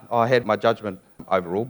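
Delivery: normal talking voice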